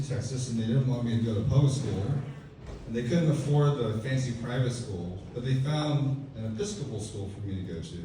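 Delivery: ordinary talk, nothing marked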